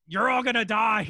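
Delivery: hammed-up goon roar; mock villain yell. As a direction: yell it like a movie thug. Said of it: "the other dude is like".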